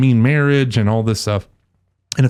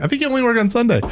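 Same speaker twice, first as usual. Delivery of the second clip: much higher mock jab; teasing jab; 11 semitones higher, much faster than usual. Host retelling his teasing of the priest. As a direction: needle him playfully. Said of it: "And I was like".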